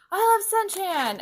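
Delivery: high, loud fangirl declaration; hammed-up lovestruck squeal of words; mock gushing. Mimicking girls declaring their love.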